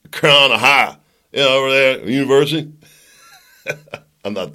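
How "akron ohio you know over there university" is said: loud, hammed-up Midwestern accent. Shown as a demonstration. Host tries out his family's regional speech, Midwestern accent. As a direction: lay on a broad Midwestern twang, loudly. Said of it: "I'm gonna do it in the Midwestern".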